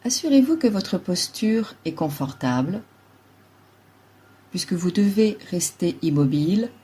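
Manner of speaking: ordinary talk, nothing marked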